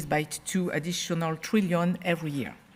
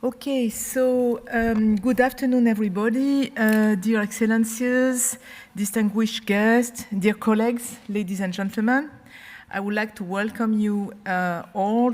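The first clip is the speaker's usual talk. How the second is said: podium speech; 3 semitones higher, 3 dB louder than usual. Real, not acted. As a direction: greet the dignitaries from the podium. Formal welcome to panel.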